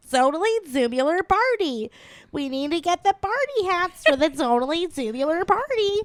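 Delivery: put-on light Valley Girl accent; breezy Valley Girl party chatter. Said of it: "we're like".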